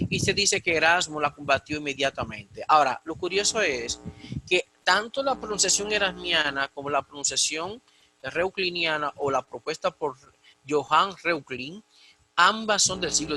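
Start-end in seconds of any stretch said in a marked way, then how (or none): none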